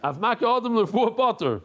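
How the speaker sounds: giggling while reading the line; laughing through the words. Real, not acted. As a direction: read it while still laughing.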